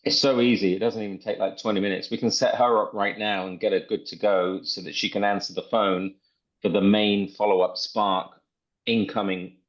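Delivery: usual speaking voice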